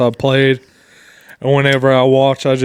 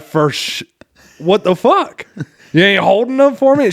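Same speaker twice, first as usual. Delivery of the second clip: a hammed-up indignant cry at the liquor store clerk; retold outraged surprise; 7 semitones higher, 4 dB louder than usual. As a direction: voice jumps much higher and louder in mock disbelief.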